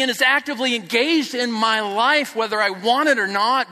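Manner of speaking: loud, high impassioned preaching; preaching at full force. Real, not acted. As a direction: drive it home to the congregation.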